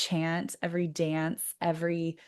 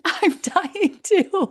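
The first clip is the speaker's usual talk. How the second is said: hammed-up tragic cry, higher, louder and slow; mock dramatic lament; 13 semitones higher, 9 dB louder, much slower than usual. Mock-tragic wail to the dying toad. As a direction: wail it like a melodrama. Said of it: "having this dramatic moment together".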